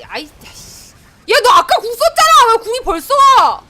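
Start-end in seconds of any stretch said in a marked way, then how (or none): 1.3-3.6 much higher, much louder outraged shout; angry yell. Real, not acted. Gamer yelling at enemy ult coming back, furious shout.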